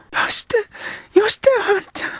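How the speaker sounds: put-on high, loud girl's fierce interruption; a girl sharply cutting him off; girl cutting in fiercely. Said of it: "激しく遮った".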